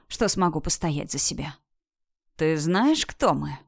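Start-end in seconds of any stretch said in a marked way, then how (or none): none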